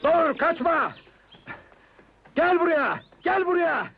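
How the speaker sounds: loud, high-pitched chasing shout; shouted command. Acted. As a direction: yell after someone running away. Chasing someone who runs away. (kaçanın arkasından bağırarak)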